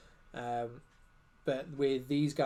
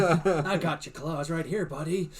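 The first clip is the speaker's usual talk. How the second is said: slow, put-on tough-guy voice with a laugh in it; half-laughing mock superhero threat; mock Wolverine voice; 3 dB louder, slower than usual. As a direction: tease them like a hammed-up Wolverine. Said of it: "What accent was that?".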